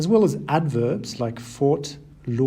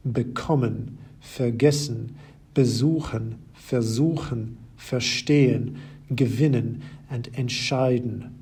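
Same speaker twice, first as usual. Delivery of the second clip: each verb stressed on the stem after the prefix, as in Bekommen and vergessen; stress on the second half. Shown as a demonstration. Teacher to German learners, stressing second half. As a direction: list them with the stress falling on the second half. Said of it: "have their stress on the second half of the word".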